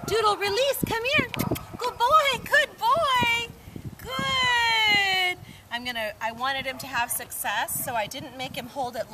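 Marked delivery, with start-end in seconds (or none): 0.1-5.4 way up high, happy dog praise. Real, not acted. Trainer calling her dog back in a high voice. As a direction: squeal it up high for him.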